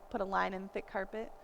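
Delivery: normal talking voice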